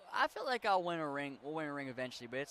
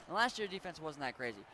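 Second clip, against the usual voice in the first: ordinary talk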